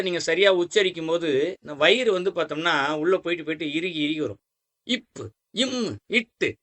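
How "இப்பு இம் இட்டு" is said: "இப்பு, இம், இட்டு" with each closed consonant pressed and held. Shown as a demonstration. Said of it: "நல்லா சொல்லி அழுத்தமா சொல்லி பாருங்க". ("இப்பு, இம், இட்டு" என மெய்யெழுத்துகளை அழுத்தி உச்சரித்தல்)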